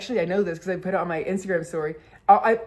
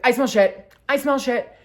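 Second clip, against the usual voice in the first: loud, higher mock alarm; sudden alarm; 5 semitones higher, 5 dB louder than usual. Daughter perking up at a smell, mock-alarmed. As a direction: blurt it like a sudden discovery.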